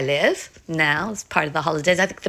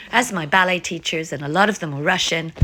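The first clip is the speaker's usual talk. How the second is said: ordinary delivery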